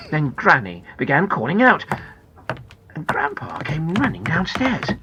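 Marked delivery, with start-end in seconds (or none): none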